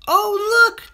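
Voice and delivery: high, loud cry of delight; excited children. A narrator's reading. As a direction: kids spotting a new toy. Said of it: "they cried".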